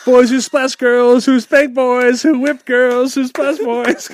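high, loud, slow sing-song taunt; excited mocking. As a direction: ham up the silly list. Mocking the holiday clue.

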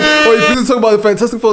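laughing. Retold or imitated. Quotes friend, laughing.

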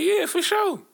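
very high-pitched eager reply; eager agreement. Retold or imitated. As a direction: squeak out an over-keen yes. Acts out own eager reply.

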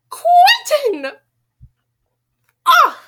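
loud, high exasperated shout; yelling at character. Real, not acted. Reader yells at Quentin in frustration. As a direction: scream his name in frustration.

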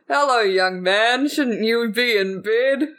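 slow, drawn-out Santa voice after a chuckle; jolly Santa. Acted. Santa catching a boy out of bed, slow and jolly. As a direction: draw it out like a kindly Santa.

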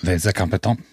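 mock French accent; hammy made-up French phrase; fake French. Shown as a demonstration. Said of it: "Speak French".

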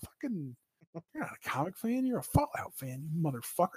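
soft, muttered put-down; muttered retort. Retold or imitated. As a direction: grumble it under your breath.

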